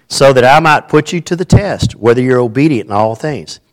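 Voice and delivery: scripture reading. Acted. Reads scripture to congregation.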